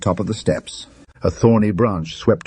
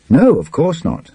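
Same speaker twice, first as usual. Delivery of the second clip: irritable, higher snap of denial; testy retort; 9 semitones higher, 4 dB louder than usual. Acted. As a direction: brush him off, annoyed. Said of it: "said Burton testily".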